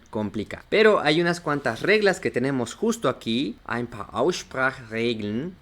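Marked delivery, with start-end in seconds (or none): 3.7-5.5 slow, careful German "Ein paar Aussprachregeln"; pronunciación alemana. Shown as a demonstration. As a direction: model the German slowly, syllable by syllable. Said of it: "así se dice". (alemán pausado y didáctico)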